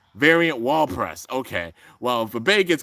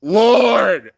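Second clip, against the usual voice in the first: loud, high cry of amazement; big high exclamation of disbelief; stunned exclamation; 6 semitones higher, 7 dB louder than usual. Real, not acted. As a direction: belt it out in shock. Exclaiming at the shocking result.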